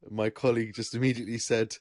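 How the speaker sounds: ordinary talk, nothing marked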